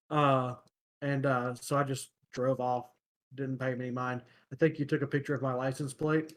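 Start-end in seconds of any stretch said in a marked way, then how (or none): none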